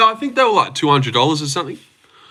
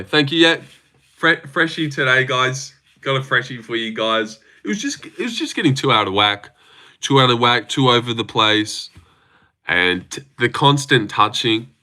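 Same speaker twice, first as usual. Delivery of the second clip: usual speaking voice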